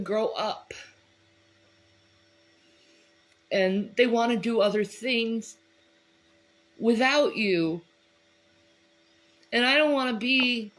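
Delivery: fighting back tears while speaking; after crying. Real, not acted. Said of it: "I'm getting all teary-eyed".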